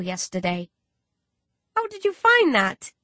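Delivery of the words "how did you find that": high, bright yelp of delight. A narrator's reading. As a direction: yelp it out with sudden joy. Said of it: "I yelp with delight".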